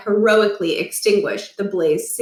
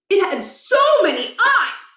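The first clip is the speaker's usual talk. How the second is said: put-on high, rushing boyish voice full of wonder; a kid breathlessly telling about a video game; excited kid Stuart; 11 semitones higher, 3 dB louder than usual. Stuart gushing to friends about a game boss, high and excited.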